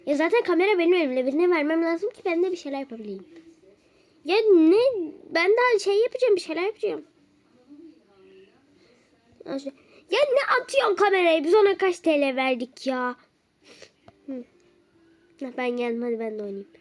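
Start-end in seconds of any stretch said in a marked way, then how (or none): 4.2-7.1 high, loud irritated protest; high exasperated retort. Real, not acted. Wife protests being given camera. 10.1-13.1 high, loud scolding question; sharp high rebuke. Real, not acted. Camera thrown at her.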